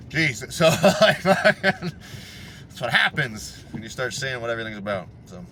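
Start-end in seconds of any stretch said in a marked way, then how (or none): 0.0-1.9 words broken by a real laugh. Real, not acted. Driver laughs at his own rant while talking.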